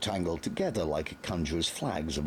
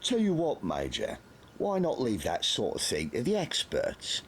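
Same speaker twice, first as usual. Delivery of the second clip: higher, sly interrupting voice; smug sergeant; 7 semitones higher than usual. A narrator's reading. Sergeant Carter interrupts the Major, smug. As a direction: cut in with a grin.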